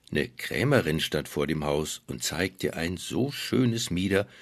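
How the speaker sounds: plain reading voice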